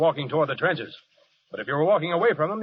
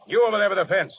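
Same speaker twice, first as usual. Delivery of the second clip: calling out; 5 semitones higher, 4 dB louder than usual. Acted. Calling to a soldier by the fence.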